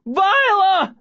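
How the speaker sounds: high, anguished full shout; screaming her name. Acted. Boy calling to drowning Viola, anguished scream. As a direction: cry out to her in terror.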